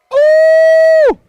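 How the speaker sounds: loud high-pitched ooh; high excited ooh. Real, not acted.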